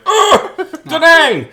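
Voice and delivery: high, loud mock gasp of attraction; stunned exclamation. Vampire smelling the girl walk in, loud high mock exclamation. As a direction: blurt it like he's hit by her smell. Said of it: "he was like".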